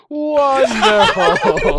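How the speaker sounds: drawn-out, high, loud mock wrestler "Wonderful". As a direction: ham up the wrestler catchphrase. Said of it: "you did the voice".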